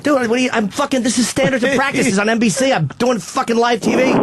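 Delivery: high, fast exasperated rant. Retold or imitated. Telling Patrice off backstage, fast and exasperated. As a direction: rattle it off in frustration.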